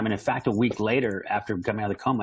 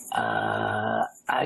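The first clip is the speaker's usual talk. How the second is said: weak, groggy whisper just out of a coma; mock-feeble whisper on the phone; dazed whisper; 11 semitones lower than usual. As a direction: fumble the words in a faint hush.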